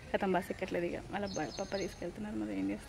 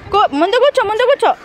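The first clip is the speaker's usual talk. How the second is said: urgent instruction; 11 semitones higher, 9 dB louder than usual. Real, not acted. Mother seating son on the bike, urgent instruction. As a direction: urge the little one loudly to sit. Urging child to sit in front.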